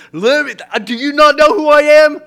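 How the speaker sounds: loud, high indignant outburst; hammed-up shout of self-importance; mock tantrum. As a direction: demand it like a spoiled celebrity. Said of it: "run up and down the aisle going".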